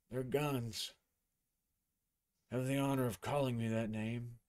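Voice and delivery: quietly honor the new gunslinger; a hint of a proud, civil master; dapper instructor. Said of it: "And he says".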